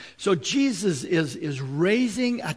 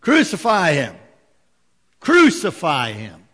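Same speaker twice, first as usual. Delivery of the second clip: loud mob shout, hammering each word; loud chanting cry of the mob; crowd yelling; 9 dB louder than usual. Retold or imitated. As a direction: yell it like an angry crowd demanding death. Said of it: "they would yell out".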